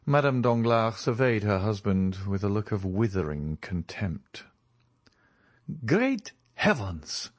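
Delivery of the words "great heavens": sudden exclamation. A narrator's reading. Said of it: "suddenly exclaimed Debray".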